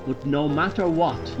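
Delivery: ordinary delivery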